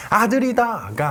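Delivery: bright, excited cry of a new parent; proud happy exclamation; joyful announcement. Shown as a demonstration. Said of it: "아니라".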